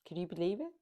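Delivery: ordinary delivery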